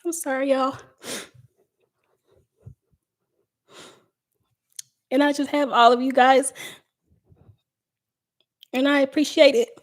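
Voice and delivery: real tears breaking through the thanks; choked up, apologizing to viewers for crying; fighting tears. Real, not acted. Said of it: "ugh, I'm not gonna cry".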